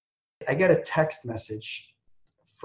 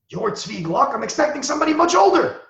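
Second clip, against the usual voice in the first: surprised disbelief; 11 semitones higher than usual. Retold or imitated. Mendy meeting the young man, surprised and rising high. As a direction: pitch jumps way up on older, mock astonished. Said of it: "he goes".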